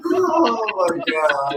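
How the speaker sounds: ordinary talk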